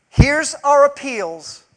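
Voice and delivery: ordinary talk, nothing marked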